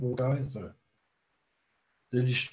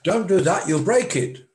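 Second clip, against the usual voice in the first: put-on higher, louder sharp warning to a child; parent warning child; 6 semitones higher, 7 dB louder than usual. Parent warning a child away from a toy, sharp and loud. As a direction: call out like a parent stopping a child.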